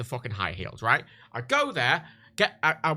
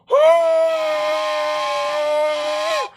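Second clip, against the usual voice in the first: muffled whistle scream; 12 semitones higher, 6 dB louder than usual. Shown as a demonstration.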